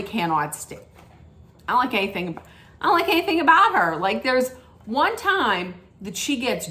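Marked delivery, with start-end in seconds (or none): 2.8-4.0 high, fast rush of dislike; exasperated burst. Real, not acted. Vlogger blurting out her dislike of a castmate, high fast exasperated burst. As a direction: blurt it out all at once, pitched up.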